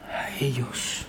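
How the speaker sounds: breathy whispered ending. Real, not acted. Preacher whispers the end of the warning, hushed. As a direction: drop to a whisper for the final words.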